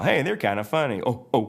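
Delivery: chuckle-free amusement, then imitate their hoots; Mater. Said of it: "what to do with Mater".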